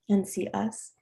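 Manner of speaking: ordinary talk, nothing marked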